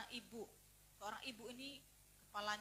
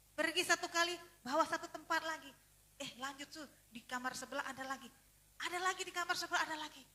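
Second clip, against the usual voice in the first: loud, high-pitched urging; urgent orders; 4 semitones higher, 11 dB louder than usual. Retold or imitated. Holy Spirit sends doctor to more rooms, loud and urgent. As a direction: keep pushing them on to the next room.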